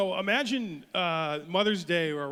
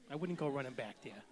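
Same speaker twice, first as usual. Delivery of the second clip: put-on soft, lower New England politician's accent; soft-spoken Kennedy; 4 semitones lower, 8 dB quieter than usual. Politely refusing the restaurant, soft New England accent. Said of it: "almost like a soft-spoken Kennedy".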